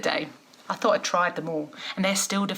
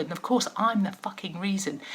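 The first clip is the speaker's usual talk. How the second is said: ordinary delivery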